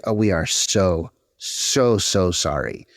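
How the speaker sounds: drawn-out, syrupy fake sorrow; mock apology. Customer service rep soothing an angry caller, mock syrupy. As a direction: lay the apology on thick, slowly. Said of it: "And then we would say".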